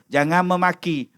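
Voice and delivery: normal talking voice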